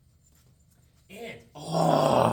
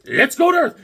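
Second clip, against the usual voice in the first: mock villain; 11 semitones higher, 5 dB louder than usual. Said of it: "So Ether's like".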